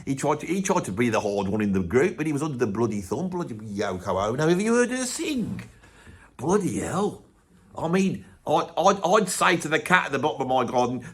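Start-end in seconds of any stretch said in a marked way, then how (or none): none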